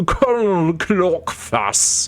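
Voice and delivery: slow, drawn-out grand title; pompous title. Pretend. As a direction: announce it grandly.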